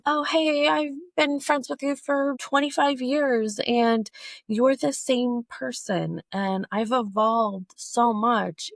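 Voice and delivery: high-pitched, slow drawn-out quote; acted friend talk. Speaker on friends of twenty-five years, drawn-out acted address. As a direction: draw it out, pitched well up. Quoting a long-time friend situation.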